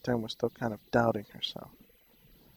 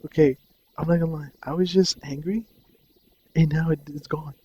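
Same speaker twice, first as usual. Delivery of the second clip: higher and louder, friend's amazed rush; excited retelling; 4 semitones higher, 6 dB louder than usual. Retold or imitated. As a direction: sell the friend's astonishment. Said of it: "He was like".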